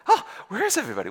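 quick, high gasp of retold bewilderment; startled confusion. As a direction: act out waking up lost, in a hurry. Woman comes to, confused.

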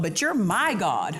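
"my" stretched and punched in "my God"; personal stress on MY. Shown as a demonstration. Preacher models thanking God as his own, stressing "my" with conviction. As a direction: claim it as yours, landing hard on "my". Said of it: "you take personal ownership".

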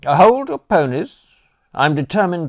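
loud, high protest; exclaiming. Acted. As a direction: stop him, eagerly. Bertie cuts Jeeves off.

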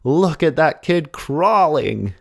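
put-on high, drawn-out exclamation in an American accent. Astonished coach spots the boy swimming, exclaimed in an American accent. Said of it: "I don't know why he has an American accent".

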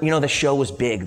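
ordinary delivery

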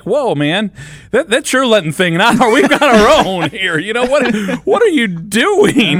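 family reacting. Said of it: "they're like".

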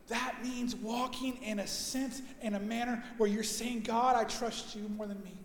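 preacher softening to a gentle appeal; soft preaching. Acted. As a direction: draw them in quietly. Quiet, intimate preaching.

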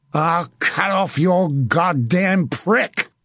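high, slow, furious threat; screamed threat. A narrator's reading. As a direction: stretch each word in vengeful rage. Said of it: "he screamed at the man".

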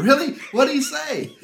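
imitating an excited wife, very high and loud; fired up. Husband imitates his wife hearing his news, fired up. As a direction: squeal the questions in mock eagerness. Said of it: "she's fired up".

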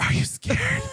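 high unvoiced mock whisper. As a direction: echo his creepy whisper, mocking. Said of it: "he whispers".

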